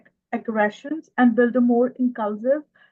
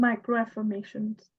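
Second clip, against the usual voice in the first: bashful, softer voice; shy; 5 dB quieter than usual. Real, not acted. Student repeating the term for the teacher, shy and soft. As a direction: answer timidly, keeping the volume down. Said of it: "इतना शर्मा के क्यों बोल रही हैं".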